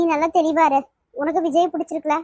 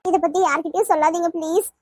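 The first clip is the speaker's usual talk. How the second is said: ordinary delivery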